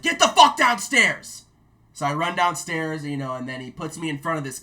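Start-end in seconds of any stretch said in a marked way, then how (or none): 0.0-1.4 slow, high, loud barked order; drill sergeant shouting. Retold or imitated. Drill sergeant sending the culprit downstairs, shouting. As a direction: yell it with every word stretched. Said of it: "he's like".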